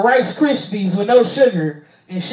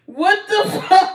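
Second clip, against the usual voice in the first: laughing; 8 semitones higher than usual. Real, not acted. Still laughing at the joke.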